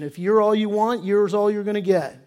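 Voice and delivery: usual speaking voice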